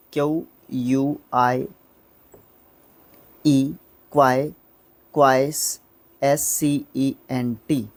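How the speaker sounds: slow letter-by-letter spelling of क्विस्केंट, each letter set apart. Shown as a demonstration. Teacher spelling the word for students, slow and deliberate. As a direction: spell Q यू आई ई एस सी ई एन टी slowly with gaps between letters. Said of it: "मैंने इसको दो syllable में तोड़ दिया".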